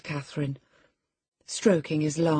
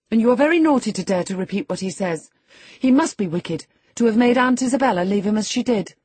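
put-on higher, louder rebuking girl; indignant scolding; 7 semitones higher, 6 dB louder, faster than usual. A narrator's reading. Young lady rebuking her cousin for repeating it, indignant and loud. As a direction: scold him hotly. Catherine scolds Linton in quarrel.

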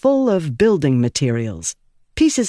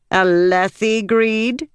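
demanding; 6 semitones higher, slower than usual. Acted. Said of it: "Ialai demanded".

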